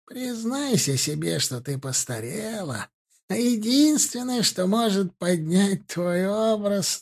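put-on high, slow, sneering old hag; mock her like a cruel witch; taunting crone.

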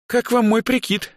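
put-on high, perky girlish voice; high-pitched, playful girl asking for approval; showing-off girl. A narrator's reading. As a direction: show off the new outfit in a high voice. Girl twirls showing off outfit.

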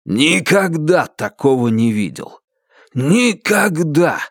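slow, emphatic admiration; drawn-out, impressed exclamation; delighted amazement. A narrator's reading. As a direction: marvel at it, stretching the words. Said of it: "восхитился Стив Бернхард".

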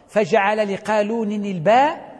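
ordinary talk

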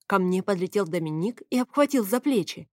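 plain reading voice

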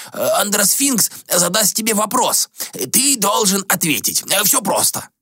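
a lightly voiced reproachful sage; old ascetic. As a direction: scold the prince like a worried elder. Gomon explains.

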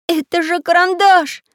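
high, loud exclamation; excited cry. A narrator's reading. Самоделкин recognising his friend's voice, crying out. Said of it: "закричал Самоделкин".

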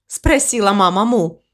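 ordinary delivery

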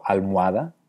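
ALMOHADA as al-MUA-da, rising question; transformed diphthong. Shown as a demonstration. Teacher offers the third option, almuada with altered vowel. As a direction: turn the O of almohada into U. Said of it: "transformando una de las dos vocales".

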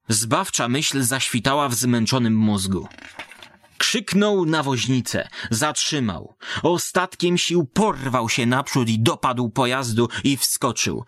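plain reading voice

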